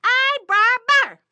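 high, loud, drawn-out holler. Acted. Trapped rabbit hails the passing bear, high drawn-out holler. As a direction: call out long and high to someone far below. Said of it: "he hollers again".

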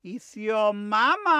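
wolf's high mama falsetto; highest voice. Acted. Wolf pretends to be the sheep's mama, highest voice. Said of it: "in his highest voice".

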